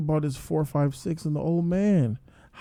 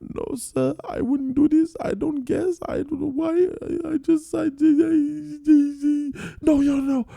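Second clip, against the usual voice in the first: very high, tearful pleading voice; imitated sobbing denial that breaks apart; crying; 13 semitones higher, slower than usual. The Indian man denying he guesses, sobbing. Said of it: "You're crying every single time you win".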